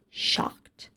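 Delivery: whispered in shock; a hushed, shocked whisper; stunned whisper. Real, not acted. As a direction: breathe the word out, stunned. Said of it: "Just shocked".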